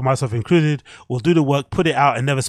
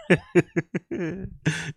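laugh; 4 semitones higher, 5 dB quieter than usual. Real, not acted. Laughing at lookalike player.

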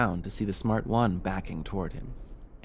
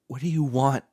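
frightened question, higher and louder; shaky, whimpering question; 6 semitones higher, 7 dB louder than usual. A narrator's reading. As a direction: ask it like a whimpering tot. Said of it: "in a shaky whisper, like a whimpering tot".

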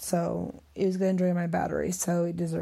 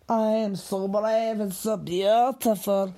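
slow, deliberate self-praise; earnest self-pep talk; self-affirmation; slower than usual. Real, not acted. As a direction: tell yourself firmly you're brave. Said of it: "I should say it".

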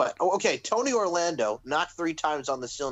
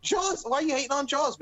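high indignant; 9 semitones higher than usual. Real, not acted. Outrage about Jaws.

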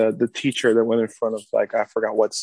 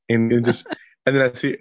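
ordinary talk, nothing marked

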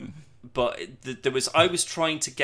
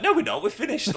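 hasty reply; 12 semitones higher, 5 dB louder than usual. Retold or imitated. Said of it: "someone instantly was just like".

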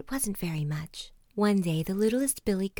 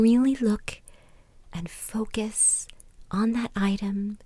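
guided meditation; 5 dB louder than usual. Acted. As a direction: ease them gently inward, pausing between phrases.

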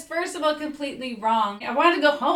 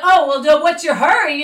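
higher, louder mock-cajoling quote; retold pushy stalling; 4 semitones higher, 9 dB louder than usual. Boyfriend stopping her at the door, mock pushy. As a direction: imitate him pressing, raised pitch and volume.

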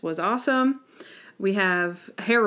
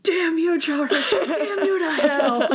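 higher, hammed-up furious Monk; mock anger; 7 semitones higher than usual. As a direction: rage at Charlie theatrically. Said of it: "And then there's anger".

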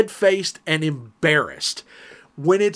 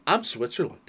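dry deadpan; 9 dB quieter than usual. Retold or imitated. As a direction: play it quietly neutral. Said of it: "my dad, in the ultimate".

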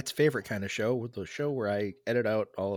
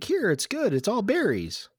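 high-pitched quote; 10 semitones higher than usual. Retold or imitated. Voicing a person handing dessert.

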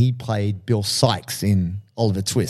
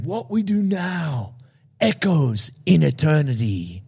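slow, raised-pitch battle speech; imitating Maximus; 5 semitones higher, slower than usual. Maximus rousing his men before battle, slow and high. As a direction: intone it slowly like an epic hero. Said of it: "and he goes".

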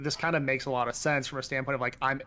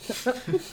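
high laugh; 11 semitones higher than usual. Real, not acted. Laughs at friends' question.

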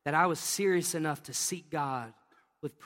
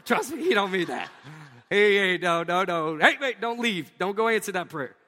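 louder, amused and urgent; God stopping an angel, louder and urgent; voicing God; 2 semitones higher, 6 dB louder than usual. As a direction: call after the messenger to stop the answer. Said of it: "that God went".

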